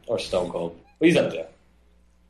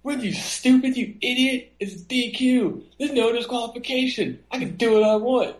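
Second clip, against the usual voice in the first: much higher, sneering heel voice; mocking Orton; 13 semitones higher than usual. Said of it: "Randy Orton looks at the guy and says".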